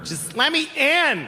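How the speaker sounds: put-on high, slow Mexican accent; a hammed-up Mexican accent, pleading. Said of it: "offensive stereotype of a Mexican".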